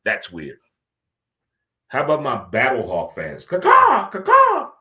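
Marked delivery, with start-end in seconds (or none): 3.4-4.7 high, loud imitated hawk caw. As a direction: caw like a hawk for the fans, pitched way up. Hyping Battlehawks fans.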